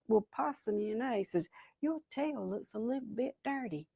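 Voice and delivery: very soft, confiding remark; sly Rabbit. Acted. Rabbit slyly breaks bad news.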